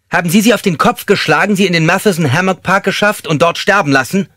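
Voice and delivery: loud, high, intimidating accusation; angry interrogation. Acted. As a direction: hurl the accusing question with full force. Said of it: "vor ihren zornigen Fragen".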